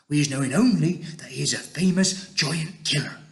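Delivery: lightly voiced giant character; BFG giant character, earnest. BFG telling Sophie of Jack, mildly awed.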